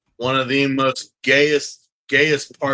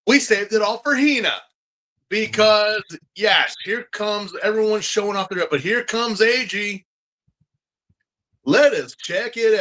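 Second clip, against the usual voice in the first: runway announcer; 5 semitones higher than usual. Pretend.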